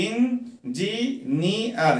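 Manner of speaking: इंजीनियर drawn out slowly, syllables stretched. Shown as a demonstration. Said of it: "इसे हम syllable में किस तरह से कहेंगे".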